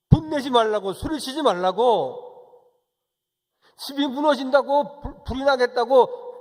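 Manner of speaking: loud, high, insistent exhortation; impassioned preacher at full voice; forceful preaching. Real, not acted. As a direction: hammer out each prohibition. Preacher hammers home the command.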